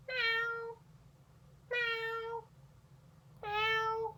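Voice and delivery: high meow toward the owner; upward meow. Shown as a demonstration. Cat greeting its owner above, high meow. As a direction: meow up as if asking for food. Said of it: "I just naturally tilt my head upwards".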